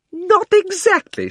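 put-on very high, loud, slow outraged girl; outraged shout. Polly shouting at the Queen in outrage. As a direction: shriek it back in disbelief. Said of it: "shouted Polly".

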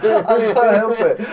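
ordinary delivery

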